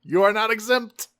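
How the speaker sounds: hammed-up mocking villain; gloating villain catching the snickering traitor; gloating Megatron.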